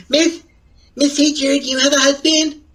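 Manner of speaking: put-on high childish kid voice; baby voice. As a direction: ask it like a little child. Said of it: "I hate when he does this baby voice".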